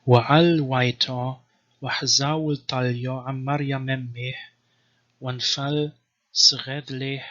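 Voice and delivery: slow, measured reading of Syriac Gospel text; chanted-style Syriac reading; scripture recitation. Shown as a demonstration. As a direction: recite the verse as sacred text. Reads Syriac Gospel aloud.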